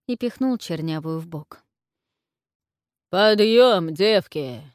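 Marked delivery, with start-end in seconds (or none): 3.1-4.6 thunderous shout; громовой окрик. A narrator's reading. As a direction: boom it across the bedchamber. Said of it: "Громом прокатился по опочивальне княжеский голос". (громовой княжеский окрик)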